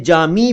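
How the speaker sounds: जामी ending in a long ee; variant pronunciation. Shown as a demonstration. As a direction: say जामी as "jaa-mee". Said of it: "इसके कई उच्चारण हैं". (जामी का उच्चारण, अंत में ई)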